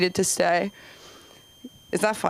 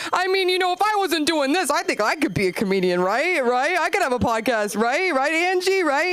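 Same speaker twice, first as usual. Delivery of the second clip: much higher, needy wannabe-comedian dentist; dentist fishing for praise; 7 semitones higher than usual. Retold or imitated. Dentist asking hygienist Angie to agree, high and needy. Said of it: "he's like".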